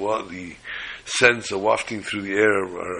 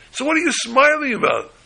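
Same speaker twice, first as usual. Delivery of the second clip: sharp, much higher, louder incredulous question; astonished demand; 5 dB louder than usual. Retold or imitated. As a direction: sound utterly baffled by the smile.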